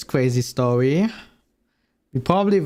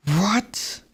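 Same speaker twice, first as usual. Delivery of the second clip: shocked whisper; 7 semitones higher than usual. Real, not acted. Shocked by abuse list.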